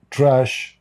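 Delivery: usual speaking voice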